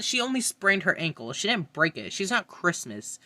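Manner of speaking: ordinary delivery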